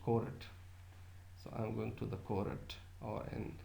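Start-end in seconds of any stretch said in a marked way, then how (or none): 0.0-0.4 "court" in two syllables, "ko-rut", r sounded; Punjabi pronunciation. Shown as a demonstration. Lecturer citing local speech, two-syllable Punjabi "court". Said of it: "in Punjabi, we may say it". 2.2-2.7 "court" as "ko-rut" inside a sentence; Punjabi pronunciation. Shown as a demonstration. Lecturer giving an everyday example, two-syllable Punjabi "court". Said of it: "we may say it court".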